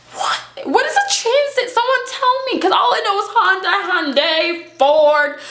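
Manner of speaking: very high, incredulous squeal; comic high-pitched bewilderment; exasperated bafflement. Retold or imitated. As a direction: plead for an answer, hammed-up confusion. Acted bafflement at car name.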